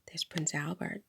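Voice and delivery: hushed, low-energy retelling of a whisper; imitated whisper. Store owner to speaker on spotting royalty, hushed whisper. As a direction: drop right down, as if passing on a secret. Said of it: "she whispered".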